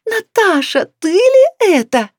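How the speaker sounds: high, loud incredulous cry; shouted recognition. A narrator's reading. Older woman greets old neighbour across canteen, shouting. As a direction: holler in amazed recognition. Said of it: "закричала она".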